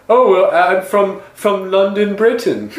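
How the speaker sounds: put-on a guy faking a British accent to impress a girl; mock fake British accent. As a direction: ham up a phony London accent, pretending to be British. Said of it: "And he was like".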